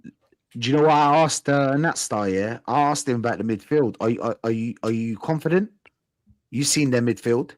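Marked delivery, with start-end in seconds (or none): none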